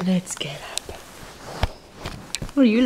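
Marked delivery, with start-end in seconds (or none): 0.1-1.0 soft whispered coaxing; whispering. Real, not acted. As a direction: whisper it gently. Whispered to baby.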